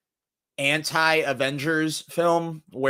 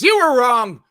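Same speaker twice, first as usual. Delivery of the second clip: high, loud gotcha; playful told-you-so; 9 semitones higher, 10 dB louder than usual. Real, not acted. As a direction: tease the viewer triumphantly. Teasing the audience.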